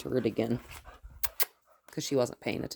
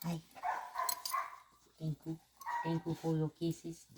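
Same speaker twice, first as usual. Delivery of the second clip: hushed, higher thank-you to the dog; quiet and tender, a touch higher; soft doggy talk; 4 semitones higher, 11 dB quieter than usual. Real, not acted. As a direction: coo it softly to a dog giving kisses. Dog licking her face.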